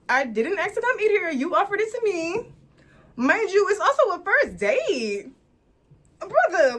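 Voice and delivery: indignant rant. Real, not acted. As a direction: pile on the grievances. Venting about date paying.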